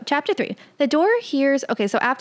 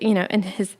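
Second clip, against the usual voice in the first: ordinary talk, nothing marked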